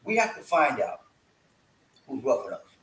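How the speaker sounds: measured, with gaps between phrases; deliberately slow. Shown as a demonstration. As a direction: spell it out slowly for listeners. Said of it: "But I gotta say it slow".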